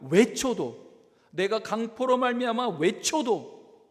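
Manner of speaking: acted desperate cry, drawn out and forceful. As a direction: shout the complaint up to God. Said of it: "막 소리소리 쳐도".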